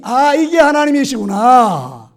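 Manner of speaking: 크고 느리게 터지는 감탄; 이제야 알았다는 듯 길게 외치기; 벅찬 깨달음 재연. Retold or imitated. 하나님을 만난 순간을 재연. (벅차게 깨닫는 감탄 흉내)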